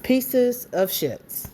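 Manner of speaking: dragged out slowly, each word hit; slow, deliberate final verdict; slow emphatic insult. Real, not acted. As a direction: spell the insult out. Repeats her insult.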